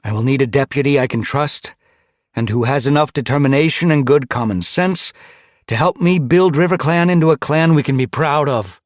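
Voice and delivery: leader's address. Acted. Ice Star asks her clan for help, leader's address. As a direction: address a whole gathering firmly.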